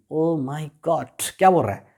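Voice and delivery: ordinary talk, nothing marked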